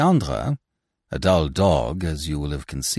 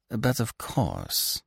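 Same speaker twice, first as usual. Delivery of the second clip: slow, soft, higher-pitched sarcasm; sleek sarcasm; 5 semitones higher, 5 dB quieter, much slower than usual. A narrator's reading. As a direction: agree with silky mock sincerity. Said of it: "She was sleekest sarcasm".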